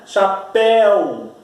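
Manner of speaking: "Chapéu" drawn out very slowly, the éu held wide open; open éu stretched. Shown as a demonstration. Teacher to students, showing how the sound opens in "Chapéu". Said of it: "como o som abre".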